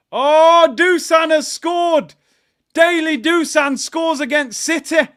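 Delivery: high, loud, jubilant yell; excited goal shout. Real, not acted. Watchalong host reacting to Dusan scoring, excited shout. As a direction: explode at a goal just scored.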